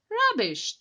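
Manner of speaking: loud, high cheeky scoff. A narrator's reading. Thomas scoffing at Henry's ghost story, loud and cheeky. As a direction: burst out dismissively, cheeky. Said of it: "Thomas laughed cheekily".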